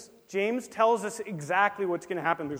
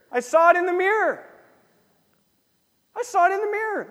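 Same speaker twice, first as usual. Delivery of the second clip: high, loud proud declaration; the believer loudly answering the skeptic; emphatic declaration; 11 semitones higher, 9 dB louder, faster than usual. Retold or imitated. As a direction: proclaim it with joy. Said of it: "You say".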